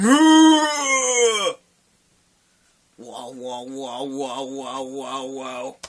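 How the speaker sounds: vibrating wobble noise. Retold or imitated. Storyteller imitating fat folds that vibrate, wobbling wow noise. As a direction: imitate a wobble dying away. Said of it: "And they vibrate every time".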